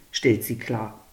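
usual speaking voice

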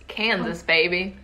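Kansas said the American way, KEN-zus; English-accent Kansas, then a playful baby. Shown as a demonstration. Telling friends where the story happens, in an American accent. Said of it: "l'accent américain ou l'accent français".

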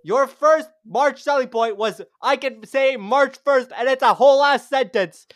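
arguing his co-host's selling point is weak, heated and mocking; heated mock rant. Real, not acted. Mocking host's argument in debate.

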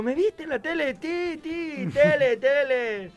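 put-on high, loud toddler babble; exaggerated high child voice; little daughter's voice. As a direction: do an excited small child, words stretched. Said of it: "¿Sos de hacerle voces?".

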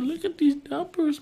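very high, squeaky dismay; exaggerated high-pitched horror; mock dismay. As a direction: squeal it as if appalled. Acting dismay at stock numbers.

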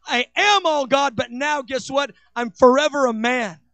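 impassioned preaching. Acted. Preaching God's love intensely.

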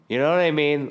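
normal talking voice

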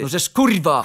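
loud exasperated curse; livid. A narrator's reading. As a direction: swear loudly, at the end of patience. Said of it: "wkurwiony na maksa". (głośne, wściekłe przekleństwo)

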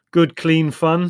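loud, drawn-out airy dismissal. Acted. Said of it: "Larry airily".

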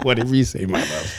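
soft, laughing yes-dear husband; meek henpecked husband. Acted. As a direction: give in with a meek chuckle. Teasing surrender to wife's claim.

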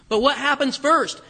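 ordinary talk, nothing marked

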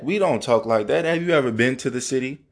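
ordinary delivery

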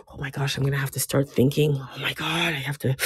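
low, soft anxious self-talk; worried mutter. Retold or imitated. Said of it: "you now have to sit up straight like".

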